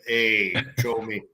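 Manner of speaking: slow, hammed-up Jersey mobster accent; mock New Jersey mobster. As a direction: play a wise guy from Jersey. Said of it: "talk like this".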